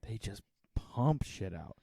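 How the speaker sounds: slow, conspiratorial whisper; grumbling under the breath about toy makers; whispered aside. Real, not acted. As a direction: let it slip out under the breath.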